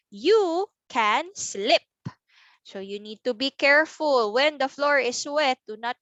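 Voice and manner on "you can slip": slow, drawn-out separate words; word-by-word reading. Shown as a demonstration. Teacher reads the next page, word by word. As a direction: take each word slowly by itself. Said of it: "how about we read our sentence".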